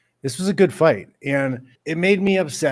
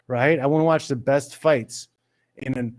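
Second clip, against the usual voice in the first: ordinary talk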